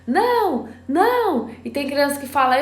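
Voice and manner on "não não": put-on shrill toddler protest; mock toddler no. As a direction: yell no like a two-year-old. Child's tantrum voiced by mother.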